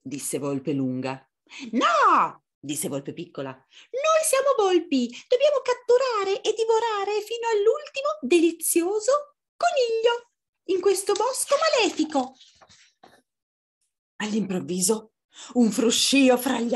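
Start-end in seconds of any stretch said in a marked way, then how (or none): 1.7-2.4 high, loud little fox no; tiny fox objecting sharply; little fox refusing. Acted. 3.5-12.3 very high squeaky little fox; bossy small fox on a tirade; little fox, fierce. Acted.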